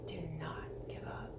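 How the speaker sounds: low, soft whispered plea. Real, not acted. Urging viewers not to give up.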